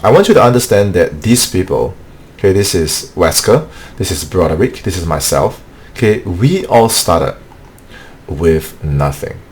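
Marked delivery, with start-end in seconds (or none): none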